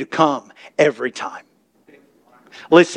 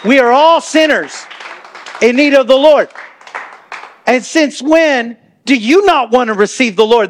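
higher, louder preacher's cry; full-force preaching; 7 semitones higher, 3 dB louder than usual. Real, not acted. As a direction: preach it out to the room.

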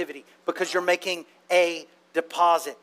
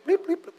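beep noises; 10 semitones higher, faster than usual. Retold or imitated. Mimicking online checkout beeps.

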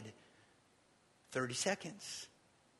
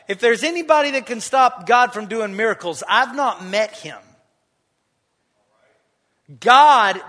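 loud, ringing preacher's declaration; full-force preaching; 5 semitones higher, 13 dB louder than usual. Acted. Preacher proclaiming God's power to the congregation, loud. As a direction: drive it home to the whole church.

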